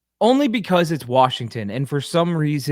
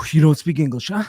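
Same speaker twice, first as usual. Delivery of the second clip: louder, pushy mock coach grilling a rookie; imitating intense coach; 2 semitones higher, 6 dB louder than usual. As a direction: get right in the kid's face, loud. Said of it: "He's like".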